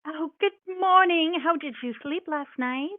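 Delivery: put-on sweet old grandmother mouse voice; high, kindly old mouse; grandmotherly mouse.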